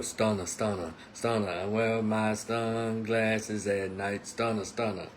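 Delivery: low, slow laid-back rap hook voice. Retold or imitated. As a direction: drop low and drawl the hook lazily. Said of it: "[name]. You know".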